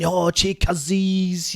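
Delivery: slow, drawn-out cuzzies said mockingly; emphasis on cuzzies. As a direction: stretch the word cuzzies out slowly, mocking. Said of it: "they'd really emphasise on the cousin".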